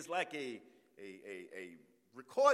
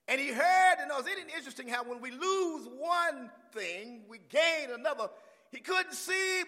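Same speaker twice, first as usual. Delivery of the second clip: raised, punched sermon phrases; full-force preaching; 6 semitones higher, 9 dB louder than usual. Real, not acted. Preacher announcing Jesus passing the blind man, fired up. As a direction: preach it with mounting excitement.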